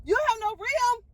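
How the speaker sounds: high, fast, near-crying disbelief; shocked disbelief. Retold or imitated. Telling the tow truck guy her spare has no rim, high stunned disbelief. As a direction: squeak it out like you can't believe it.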